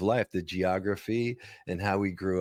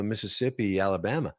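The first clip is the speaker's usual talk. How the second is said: ordinary talk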